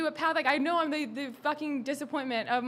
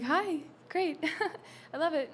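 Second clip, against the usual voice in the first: higher, put-on chirpy hostess voice with a forced laugh; fake customer-service cheer; 4 semitones higher, 3 dB quieter than usual. Bartender mocking her own forced cheerful voice to guests. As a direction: mimic the bright fake politeness of the job. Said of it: "it's my job to just be like".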